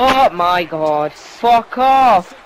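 loud, raging outburst at the screen; panicking gamer. Real, not acted. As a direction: yell it like you're losing the match. Raging gamer losing a match.

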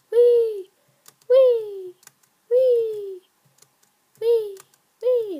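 high, loud whee sliding down; playful squeals. Pretend. Gamer bouncing from jump to jump, playful high squeals. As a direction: squeal gleefully with every hop.

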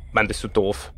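low, soft grumble; muttered insult. Real, not acted. Player grumbling at Granny, muttered. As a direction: mutter it under your breath.

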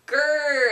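short loud playful growl; quick growl. Pretend. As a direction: snap out a little bear growl.

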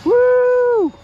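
a loud, high whoop of delight; excited whoop. Real, not acted. Pilot cheering his plane's pass, a loud high whoop. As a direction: cheer the jet as it screams past.

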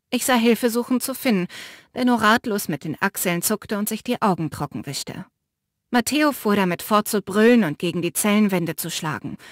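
usual speaking voice

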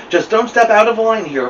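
ordinary delivery

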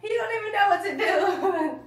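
laughing. Real, not acted. Laughing at victim.

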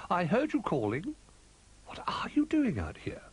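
high-pitched, lilting owl character; surprised owl in a high voice; storybook owl. A narrator's reading. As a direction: speak high, as a curious owl.

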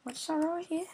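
normal talking voice

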